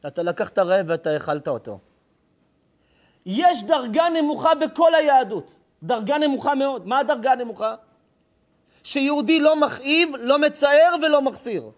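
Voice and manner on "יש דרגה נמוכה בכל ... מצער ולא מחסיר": preaching at full force. Acted. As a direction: thunder it from the pulpit. Rabbi preaching hard to crowd.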